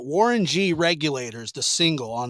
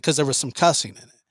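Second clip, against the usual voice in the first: ordinary delivery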